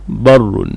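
بر as bar-run, short a; alif dropped. Shown as a demonstration. Teacher giving the form without alif, بر said barrun. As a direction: say بر with short a and doubled r. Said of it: "تحذف منه الألف ويقال".

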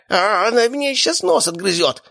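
very high pained wail; squealing in panic. A narrator's reading. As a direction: squeal as if your nose is being bitten. Bitten man squeals in panic.

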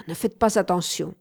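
ordinary delivery, nothing marked

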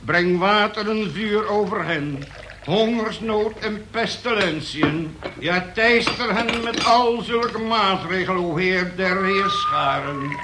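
preaching at full force; fiery prayer. Acted. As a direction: call down wrath like a preacher. Prays aloud at the group.

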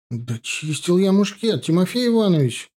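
high, aggrieved protest; sniffling young soldier. A narrator's reading. Soldier excusing his musket to the sergeant, high protest. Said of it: "шмыгнул носом Ванька".

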